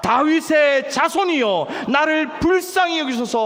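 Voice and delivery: high, loud, slow plea to be heard; crying out loud. Acted. Preacher leading the church in Bartimaeus' cry, loud slow shout. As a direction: shout it like the blind man begging.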